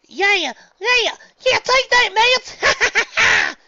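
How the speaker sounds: high, loud triumphant yell; cheering. Acted. Fan celebrating the home run, triumphant shout. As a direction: cheer with all you've got.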